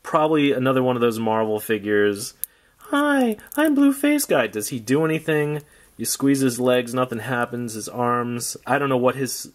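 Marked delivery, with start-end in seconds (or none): 2.8-4.5 toy figure voice. Pretend.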